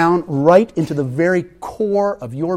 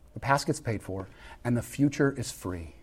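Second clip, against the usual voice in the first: quiet refrain; 3 semitones lower, 10 dB quieter than usual. Acted.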